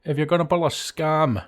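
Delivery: put-on Scottish burr; mock Scottish accent, measured delivery. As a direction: warn them in a comic Scottish accent. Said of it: "sounded better if he's like".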